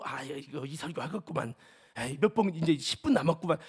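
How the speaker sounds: grumbling listener. Retold or imitated. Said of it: "이거 계산할까 봐".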